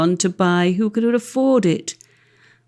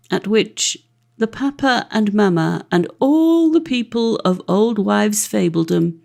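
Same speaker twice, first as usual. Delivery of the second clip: usual speaking voice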